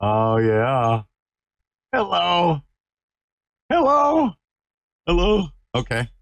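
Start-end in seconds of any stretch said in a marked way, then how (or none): 1.9-5.5 high, sing-song puppy talk. Real, not acted. Cooing at the puppy.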